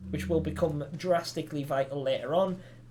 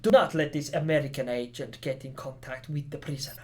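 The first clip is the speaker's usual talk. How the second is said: badly faked Spanish accent; attempted Spanish accent; 3 semitones lower than usual. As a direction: read it in an amateur's Spanish accent. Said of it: "I should be using a Spanish accent".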